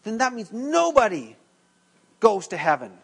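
high, loud indignant protest; angry outburst. Retold or imitated. Angry student stands up objecting to the teacher, loud indignant shout. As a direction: retell the student's angry cry of disbelief. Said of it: "his anger in his face".

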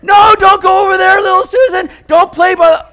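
high, loud mock-panicked mother calling a child; panicked mom. Mother warning little Susan away from the snake, mock-panicked yelling. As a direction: yell like a frantic mom pulling her kid back. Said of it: "moms are like".

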